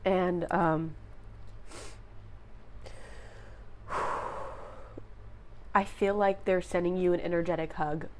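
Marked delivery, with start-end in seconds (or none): 1.0-5.7 long, soft breathy sigh. Real, not acted. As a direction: let out a slow breath to regain composure. Composing herself after getting emotional.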